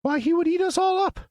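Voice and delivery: very high startled squeak; alarmed mouse queen. Acted. Mouse queen hearing of the lion, alarmed squeak. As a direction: cry out in alarm.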